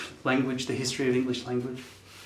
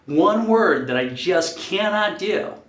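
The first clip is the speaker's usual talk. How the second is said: frustrated; 7 semitones higher, 6 dB louder, slower than usual. Real, not acted. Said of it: "It's one fucking word I don't know how to do".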